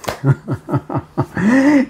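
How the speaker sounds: real burst of high chuckling; laughing. Real, not acted. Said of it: "je rigole".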